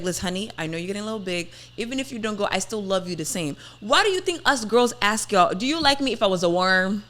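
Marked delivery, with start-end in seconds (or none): none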